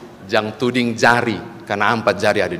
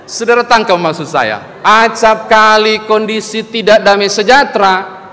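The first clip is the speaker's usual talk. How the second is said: loud, high, forceful preaching; preaching at full force; 10 semitones higher, 8 dB louder than usual. Acted. Pastor drives home the point to the congregation, preaching loudly. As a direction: press the lesson home to the congregation.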